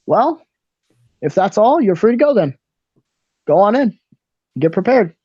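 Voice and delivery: ticketmaster. Acted. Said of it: "He says".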